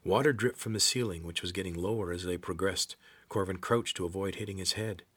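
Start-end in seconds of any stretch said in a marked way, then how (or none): none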